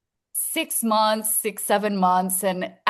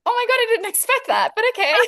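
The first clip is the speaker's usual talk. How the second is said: high, fast stunned gasp of joy; surprised and delighted; 14 semitones higher, 4 dB louder, faster than usual. Retold or imitated. Recalling her shock when boyfriend proposed, surprised and high. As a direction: play it like happy disbelief.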